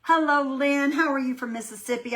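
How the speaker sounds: cheerful greeting. Real, not acted.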